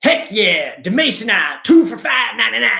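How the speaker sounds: loud, brash store clerk hyping a sale; pushy fish seller. Retold or imitated. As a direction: sell it hard like a hyped-up clerk. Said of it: "It's just like".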